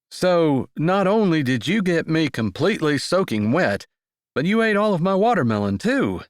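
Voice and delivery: man's voice, mock-accusing and lively; playful teasing. A narrator's reading. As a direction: tease her about the watermelon. Zeke teasing her, playful complaint.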